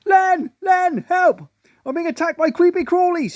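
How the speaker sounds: very high, loud frantic calls for Len; panicked cries. A narrator's reading. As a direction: scream for help as bugs attack. Wife crying for help.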